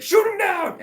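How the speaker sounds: high, loud barked command; mock army order. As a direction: shout the order to open fire. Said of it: "Red Army is just like".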